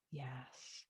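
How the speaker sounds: breathy whispered yes; soft whispered agreement. Real, not acted. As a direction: whisper it gently. Soft affirmation after anxious check-in.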